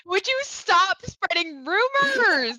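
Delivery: high, slow put-on scolding; exasperated shopkeeper. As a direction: drag it out in a whiny high voice. GM voicing Macy scolding.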